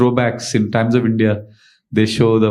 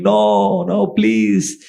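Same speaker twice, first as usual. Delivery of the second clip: raised pitch, acted begging; mock screaming plea; 7 semitones higher, much slower than usual. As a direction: plead in a storyteller's mild mock scream. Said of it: "this servant started screaming".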